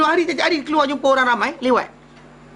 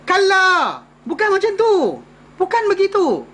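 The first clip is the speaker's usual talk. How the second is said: emphatic no; 7 semitones higher than usual. Acted. God's answer, emphatic.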